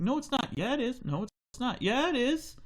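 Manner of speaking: high back-and-forth squabble; father and son squabbling, high voices; kid bickering. Acted. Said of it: "It's like".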